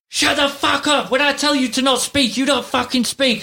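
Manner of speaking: mock furious yell, pitched very high; abusive tirade. Imitating a woman on a boat trip having a go at him, abusive. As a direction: act out her screeching telling-off. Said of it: "she's actually really abusive".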